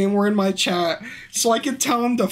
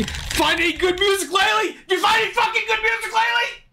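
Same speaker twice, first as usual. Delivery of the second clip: high-pitched mocking yell; mocking outburst; 11 semitones higher, faster than usual. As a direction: spit the line back, high and furious. Rants at game's dialogue choice.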